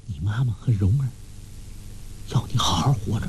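hushed, breathy words close to the ear; a secret whisper, spoken quickly; whispered in his ear. A narrator's reading. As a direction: murmur it into his ear so no one hears. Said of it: "在他耳边低声说".

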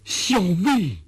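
high, astonished outburst with pauses; excited exclamation. A narrator's reading. Orderly gushing at the magistrate after a long stunned silence, excited. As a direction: cry out in rising, overjoyed disbelief. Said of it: "半晌才激动地说".

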